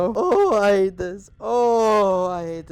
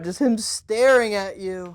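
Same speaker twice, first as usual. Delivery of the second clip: ordinary talk, nothing marked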